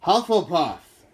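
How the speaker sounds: loud, slow, high hat shout; the hat bellows its verdict again. Acted. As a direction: draw out the house name for the whole hall. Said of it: "shouted the hat again".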